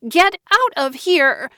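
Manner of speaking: Joanna screaming at her sister to leave; hysterical scream. A narrator's reading. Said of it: "Joanna screamed hysterically".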